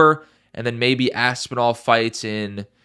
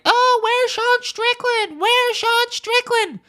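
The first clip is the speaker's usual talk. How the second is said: much higher, slow whining mockery; mock whiny fans; slower than usual. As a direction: mimic crybaby fans in a squeaky high voice. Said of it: "people are gonna be crying".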